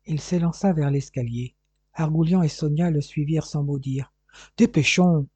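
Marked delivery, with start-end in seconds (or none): none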